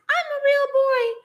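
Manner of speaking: put-on high, bright wooden-boy squeak; Pinocchio voice. Host retells a fan's comment in Pinocchio's squeaky voice. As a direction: squeak it out like a puppet desperate to be real. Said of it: "in Pinocchio's voice".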